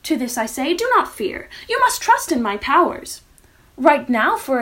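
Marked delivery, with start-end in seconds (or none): none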